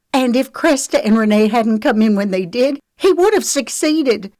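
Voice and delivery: high, loud indignant outburst; raging. A narrator's reading. Lexi tells Trent about Wiley's lies, raging. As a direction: pour out the accusation furiously. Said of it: "Lexi raged".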